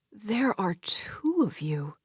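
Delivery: slow, stunned whisper; stammering. Acted. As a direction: whisper in disbelief. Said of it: "she stammered".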